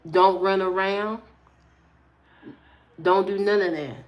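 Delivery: mama's store speech. Retold or imitated. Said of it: "She told me that speech".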